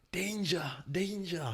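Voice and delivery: high falling danger, danger; warning danger. Retold or imitated. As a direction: warn in a high voice. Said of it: "all they could say to me was".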